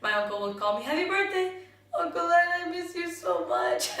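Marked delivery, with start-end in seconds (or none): 0.8-1.5 very high, loud imitation of uncle's call; cheery birthday greeting. 2.0-3.9 high, hammed-up tearful wail down the phone; mock crying. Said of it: "And I would just start crying".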